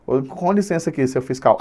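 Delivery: hushed, low excuse-me; polite request. Acted. Student to the exam invigilator, quietly polite. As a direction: softly ask the invigilator's pardon. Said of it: "chegar lá e falar assim".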